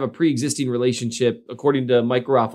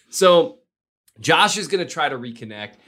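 usual speaking voice

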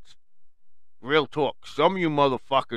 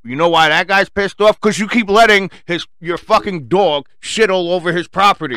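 much louder, higher outraged shouting; angry yell; 6 semitones higher, 11 dB louder than usual. Real, not acted. Yelling at the dog owner who lets his dog foul lawns, outraged. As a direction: shout him down.

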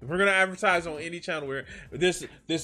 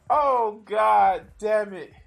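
laughing exclamation; 6 semitones higher, 5 dB louder, much slower than usual. Real, not acted. Cracking up at the clip.

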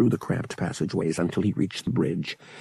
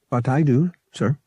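higher, mechanical droid voice; matter-of-fact droid; 5 semitones higher, 3 dB louder than usual. A narrator's reading. Droid announces it can speak, matter-of-fact. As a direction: state it evenly, like a helpful machine. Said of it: "in a matter-of-fact voice".

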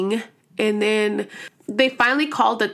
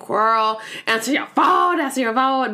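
higher, urgent begging; acted-out desperate phone plea; mock frantic pleading; 3 semitones higher than usual. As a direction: plead like nobody is picking up. Retold frantic calls for help.

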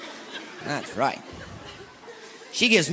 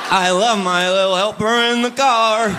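slow, louder, mock sweet; sarcastic praise; 4 semitones higher, 4 dB louder than usual. Praising his wife's backseat driving, slow and sarcastic. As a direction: drag it out sarcastically.

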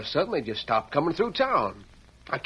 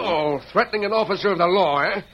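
louder, sharp arresting order; angry arrest; 5 semitones higher, 6 dB louder than usual. Acted. Sheriff arresting Tonto, loud angry order. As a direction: throw the law at him.

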